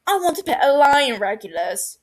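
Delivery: loud eager outburst; cries out. Acted. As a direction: blurt the wish out loud. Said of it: "Barty cries".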